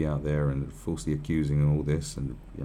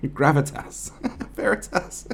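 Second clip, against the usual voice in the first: laughing at own slip; 8 semitones higher, slower than usual. Real, not acted. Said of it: "My brain's gone to mush".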